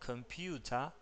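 normal talking voice

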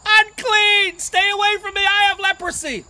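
loud, high outcast's shout; urgent full-voiced warning cry; yelled warning. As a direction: yell to keep everyone back. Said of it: "they would have to yell out".

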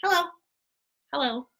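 ordinary talk

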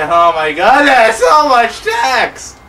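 slow, high, loud complaint; real mock-despair shout; exasperated. Real, not acted. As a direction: groan it out, fed up with reading. Exasperated by long text.

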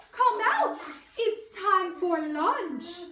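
high, loud queenly call; sing-song like a grand queen; queen's voice. A narrator's reading. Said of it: "said the queen".

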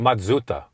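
Matsuda in three clipped syllables, mat-SU-da; Japanese Matsuda. Shown as a demonstration. Cohost vows to say Matsuda the Japanese way. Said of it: "start saying the Japanese way".

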